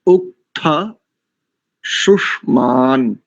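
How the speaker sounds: उक्थ शुष्मान spoken slowly, each syllable drawn out; slow recitation demo. Shown as a demonstration. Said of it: "दूसरी पंक्ति देखिए".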